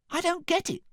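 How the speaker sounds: very high, baffled cry; Cinderella. A narrator's reading. Cinderella fails to understand, crying out very high. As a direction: complain in a very high voice. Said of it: "cried Cinderella".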